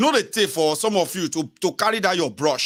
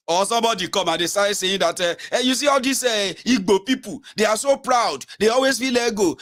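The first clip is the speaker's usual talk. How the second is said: higher, mock scornful voice; mock gossiper; 6 semitones higher than usual. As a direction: imitate a prejudiced gossip, higher. Mimics critic of Igbo people.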